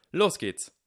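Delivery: high, loud go signal; calling out. A narrator's reading. Harry kicks off the contest, calling out. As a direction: call out the start.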